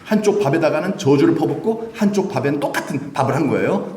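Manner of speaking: ordinary delivery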